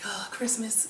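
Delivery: hushed awed whisper. Retold or imitated. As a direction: breathe it out in wonder. Awed at the Christmas shop.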